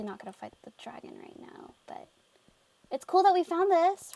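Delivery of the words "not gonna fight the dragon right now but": whispering. Real, not acted. Whispering aside.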